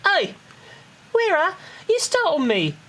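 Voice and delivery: high-pitched Cockney character voice; Tataru. Acted. Player reads Tataru's recruit orders to a friend, high Cockney voice. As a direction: put on a Cockney accent, pitched well up. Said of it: "you want me to do a Tataru Cockney accent".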